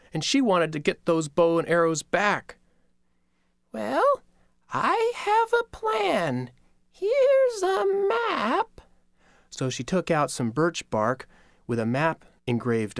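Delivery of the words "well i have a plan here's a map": very high, very slow elder; old grandmother voice. Acted. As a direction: creak it out like a kindly old spider. Spider Grandmother speaks.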